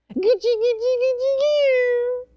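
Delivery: mocking parents cooing at their child; baby talk. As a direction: coo at a baby like a silly parent. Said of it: "We talk like this with our children".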